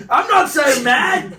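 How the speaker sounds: high, slow Cockney protest; Cockney accent. Acted. Comic answering the co-host, mock Cockney. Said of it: "doing it in Cockney".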